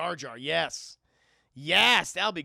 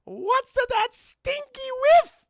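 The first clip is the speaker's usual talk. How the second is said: much higher, louder squeaky character; 13 dB louder than usual. Acted. Streamer voices a stranger in the fan fiction, high and loud. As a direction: shriek the line as a new figure. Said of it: "a voice said".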